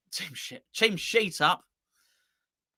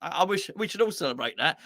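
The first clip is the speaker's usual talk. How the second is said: normal talking voice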